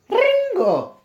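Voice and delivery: very high comic cry; sudden high mock shout; high-pitched exclamation. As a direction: call it out in a high put-on voice.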